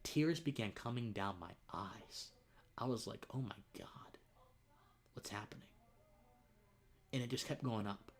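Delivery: real emotion, dropping to a hush; overcome, voice sinking almost to a whisper; hushed and choked up, holding it together; emotional. Real, not acted. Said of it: "I'm getting a little emotional right now".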